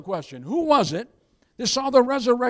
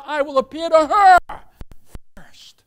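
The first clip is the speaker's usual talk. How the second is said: loud then whispered; 5 semitones higher, 8 dB louder than usual. Acted. Jesus quoted, dropping to whisper.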